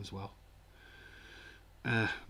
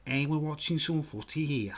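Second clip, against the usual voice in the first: high, loud Scouse impression; hammed-up Scouse telling people off; Liverpool accent; 5 semitones higher, 5 dB louder than usual. Shown as a demonstration. Streamer playing a Liverpudlian watching footy, high loud Scouse accent. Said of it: "Liverpool says".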